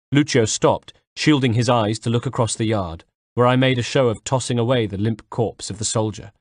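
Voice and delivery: ordinary delivery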